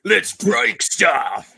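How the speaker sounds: high, slow, loud brute voice; Wrecker impression. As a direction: bellow it like a big brute.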